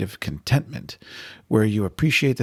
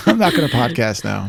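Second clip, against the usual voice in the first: laughing; 5 semitones higher, 3 dB louder than usual. Pretend. Imagines herself hurt, laughing.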